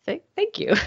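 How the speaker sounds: high, stammered mock thank-you; awkward thanks. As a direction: imitate someone unsure how to take a compliment. Acting out her baffled, awkward thanks.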